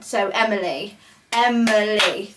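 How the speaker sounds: Emily broken into three clapped beats, E-mi-ly; clapped syllables. Shown as a demonstration. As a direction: clap out each syllable of Emily. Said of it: "I can clap them out".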